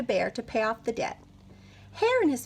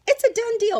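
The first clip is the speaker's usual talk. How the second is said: high, louder gleeful sealing; high smug hare; 11 semitones higher, 6 dB louder than usual. Acted. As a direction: seal the deal with glee. Hare closing deal.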